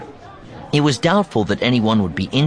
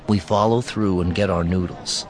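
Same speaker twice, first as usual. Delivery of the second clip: plain reading voice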